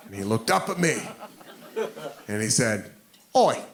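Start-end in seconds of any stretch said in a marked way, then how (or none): 3.3-3.6 put-on cocky British drunk's sneer in British accent. Drunk British guy mocking the big American, British accent. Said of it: "in that accent".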